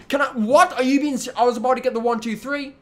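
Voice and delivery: high-pitched disbelief, breaking off mid-sentence; incredulous protest. Real, not acted. Player robbed of the one two three, incredulous. Interrupted just before the pin.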